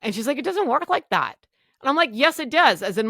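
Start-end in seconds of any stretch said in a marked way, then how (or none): 0.4-1.4 much higher, louder no-nonsense correction; firm refusal. Retold or imitated. Clinic worker refusing the patient's idea, higher and louder. 2.1-2.8 much higher, louder contradiction; defiant insistence. Retold or imitated. Patient contradicting the clinic worker, defiant and loud.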